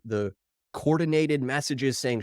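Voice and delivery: ordinary talk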